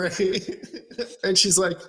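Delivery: ordinary talk, nothing marked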